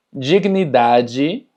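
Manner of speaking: dignidade slowly as "jignidaji"; drawn-out dignidade, "ji" at both ends; DI y DE como ji. Shown as a demonstration. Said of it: "por lo tanto es dignidade". (dignidade lento, dicho «jignidaji»)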